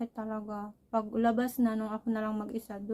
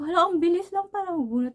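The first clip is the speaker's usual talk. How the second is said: quick, higher and louder; surprised exclamation; 7 semitones higher, 6 dB louder, faster than usual. Retold or imitated. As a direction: blurt it out in amazement.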